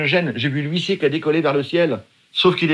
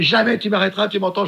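higher defiant mock yell; 6 semitones higher than usual. Swimmer defying his team at sea, higher defiant yell. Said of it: "Je hurle dans la mer".

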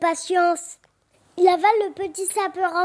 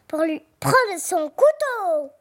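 slow wolf voice. Acted. Wolf answers slowly.

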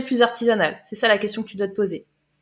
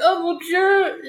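higher, slow shocked sigh; a slower, tamer gasp; mock fright calming down; milder mock horror; 7 semitones higher, much slower than usual. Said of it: "Après, tu fais".

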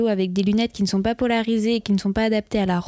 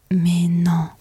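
whispered, lower disbelief; stunned whisper; 4 semitones lower, 3 dB louder than usual. Retold or imitated. Listener shocked by a story, whispered disbelief. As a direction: gasp it out under your breath. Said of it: "vous écoutez et vous dites".

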